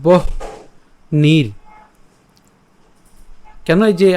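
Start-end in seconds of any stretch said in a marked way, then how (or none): 0.0-1.5 বহ্নির split slowly, h before n; broken-up pronunciation. Shown as a demonstration. Teacher breaking the conjunct in বহ্নির slowly. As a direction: stretch বহ্নির syllable by syllable. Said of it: "যুক্ত বর্ণটি ভাঙি".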